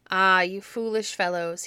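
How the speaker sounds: loud scolding exclamation; crying out. A narrator's reading. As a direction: call out to the fools. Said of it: "he cried".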